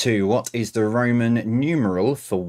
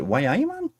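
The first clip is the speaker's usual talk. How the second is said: high, playful attempt at Geordie; a quick, slightly hammy Geordie question; Geordie accent; 8 semitones higher than usual. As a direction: try out a Geordie accent, half joking. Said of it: "my attempt at a Geordie accent".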